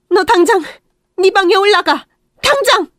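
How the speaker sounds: 높고 크게 내지르는 명령; 단호하게 소리쳐 올려보내듯; 명령하는 고함. Acted. Mother orders son upstairs. (아들을 방으로 쫓아 보내는 엄마, 고함)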